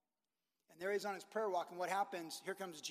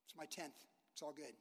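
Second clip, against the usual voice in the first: calm veteran; 4 semitones lower, 9 dB quieter than usual. Retold or imitated. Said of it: "they're just like".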